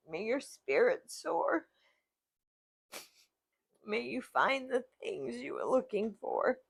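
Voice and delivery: a verse read on the edge of crying, breaking into laughs; tearful reading. Real, not acted. Reading her mom's poem aloud, crying between laughs. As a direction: fight the tears and keep reading. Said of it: "It was very emotional for me".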